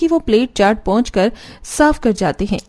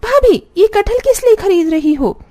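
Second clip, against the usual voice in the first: high, imperious sister-in-law; pitched-up, bossy questioning; bossy, ordering; 10 semitones higher, 3 dB louder than usual. Acted. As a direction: demand an answer in a high voice. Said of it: "order झाड़ते हुए बोली".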